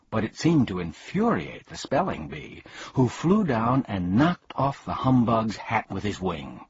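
plain reading voice

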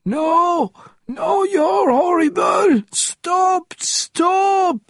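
high screams of pain; shouting while wounded. A narrator's reading. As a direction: scream it while being hurt. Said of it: "words screamed in pain".

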